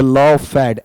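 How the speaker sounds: "लॉफेड" split as law-fed; wrong two-syllable reading. Shown as a demonstration. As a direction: say "लॉफेड" with the ed fully sounded. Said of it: "ऐसा नहीं बनेगा".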